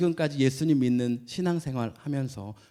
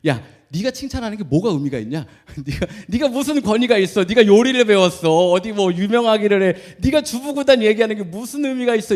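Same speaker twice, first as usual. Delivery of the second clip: loud, higher mocking rebuke; scolding pastor; 6 semitones higher, 8 dB louder than usual. Old pastor scolding a boy for his compliment, loud and mocking. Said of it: "얘기하는 거예요".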